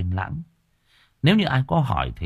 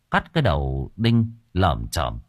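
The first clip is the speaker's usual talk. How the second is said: ordinary delivery, nothing marked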